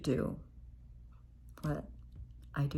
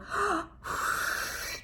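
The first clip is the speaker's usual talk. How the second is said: exaggerated inhale and whooshing exhale; pretend balloon blowing. Shown as a demonstration. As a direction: breathe in big and blow out like inflating a balloon. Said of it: "Blow up the balloon".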